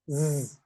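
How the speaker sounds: "സ്" as a held, buzzed "zzz"; buzzing sound. Shown as a demonstration. Teacher models letter Z for learners, buzzing "zzz". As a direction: buzz on "സ്" like a bee, "zzz". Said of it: "buzzing sound".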